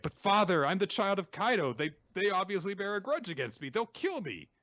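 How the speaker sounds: put-on a boy pleading with his father, high and scared; pleading child. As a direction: beg your father not to abandon you.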